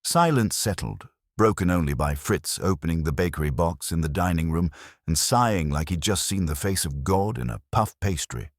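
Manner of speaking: usual speaking voice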